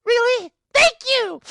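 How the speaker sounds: high, squeaky grateful gasp; delighted surprise. Acted. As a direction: squeak it like a thrilled pixie. Pixie surprised at becoming god.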